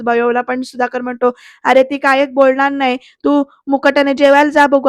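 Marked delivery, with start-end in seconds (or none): none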